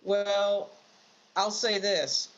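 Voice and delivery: ordinary talk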